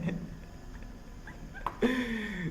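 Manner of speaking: long, high-pitched laugh; helpless laughter. Real, not acted. Host amused by how friend talks, helpless high laughter. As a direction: dissolve into soft, high giggles.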